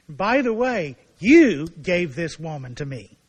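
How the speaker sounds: blaming retort. Acted. Adam turning the blame back on God, loud and slow. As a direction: lean on it like a defensive excuse.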